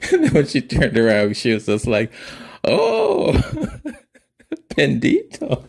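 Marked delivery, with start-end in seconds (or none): 2.6-5.6 mom's delighted squeal. Retold or imitated. Mom reacts seeing her dressed up.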